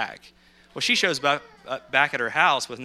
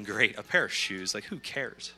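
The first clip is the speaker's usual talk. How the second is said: slow, softer, lightly sarcastic grumble; mock unimpressed; 3 semitones lower, 7 dB quieter, slower than usual. Fireman walking off after being sent for shoes, mildly unimpressed. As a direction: retell a let-down, dismissive thought, dropping lower. Fireman's dismissive thought, retold.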